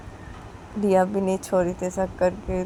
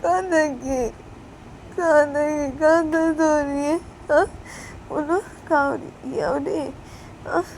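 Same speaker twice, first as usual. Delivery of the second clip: worried asking; 8 semitones higher, 4 dB louder than usual. Acted. Worried asking after child.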